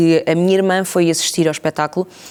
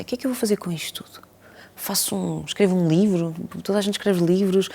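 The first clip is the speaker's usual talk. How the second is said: near-whispered musing to herself, much softer; hushed inner thought; 7 dB quieter than usual. Retold or imitated. Interviewee recalling her private doubts about the texts, hushed. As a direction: think it over under your breath, barely voiced.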